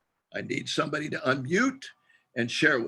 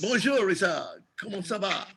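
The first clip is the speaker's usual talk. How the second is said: higher, chirpy French greeting; playful French; 7 semitones higher than usual. Pretend. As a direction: greet him in his own French. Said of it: "extent of my French".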